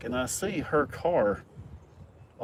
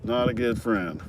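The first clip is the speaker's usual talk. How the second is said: slow dry reply. Retold or imitated. Dry reply about friend.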